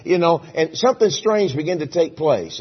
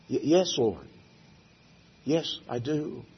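hesitant quiet answer; 6 dB quieter, slower than usual. Acted.

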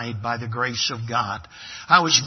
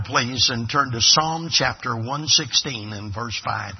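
normal talking voice

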